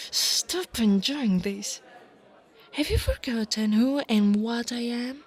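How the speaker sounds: loud, affronted reprimand; irritated, forceful rebuke; indignant scolding. Acted. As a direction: scold them loudly with wounded authority. Scolds the teasing listener.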